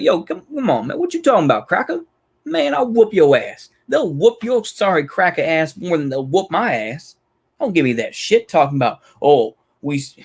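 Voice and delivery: scornful trash talk in a fake Southern accent. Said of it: "putting on somewhat of a fake Southern accent".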